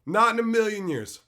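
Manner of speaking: NOT hit high and loud, refusal shouted up. Real, not acted. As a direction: bellow the refusal, stressing Not.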